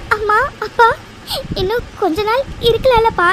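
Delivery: high-pitched tearful pleading; crying like a child. Acted. Daughter begging her departing parents to stay, crying. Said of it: "குழந்தை மாதிரி அழுதுட்டு இருக்க".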